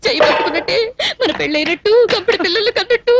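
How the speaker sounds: wailing, tearful complaint; tearful lament. Acted. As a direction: lament it through sobs. Weeping over the broken marriage hope.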